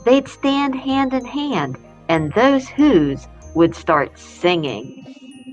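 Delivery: plain reading voice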